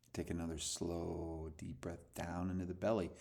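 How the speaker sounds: calm breathing coach voice; guided meditation. Acted. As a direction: ease them into letting go. Leads a breathing exercise.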